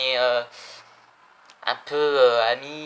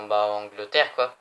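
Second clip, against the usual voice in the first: normal talking voice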